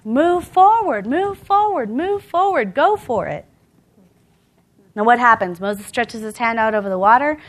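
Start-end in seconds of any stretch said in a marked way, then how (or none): 0.0-3.5 slow, high rallying chant; drawn-out, raised-pitch encouragement; cheering us on. Acted. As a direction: urge them onward with each repeat. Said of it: "the whole company of heaven behind us ... telling us".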